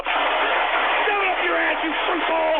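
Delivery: high, stretched-out, strained yell; furious scream. Acted. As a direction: scream them down, dragging the words. Host screams at troll.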